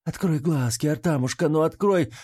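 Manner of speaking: high-pitched, urgent, plaintive coaxing; tearful pleading. A narrator's reading. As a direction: beg him to wake through tears. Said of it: "плачущую Ниелу".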